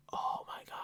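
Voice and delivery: breathless hushed oh my God; awed whisper. Retold or imitated. Reliving the set list moment, awed whisper. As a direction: whisper it in disbelief.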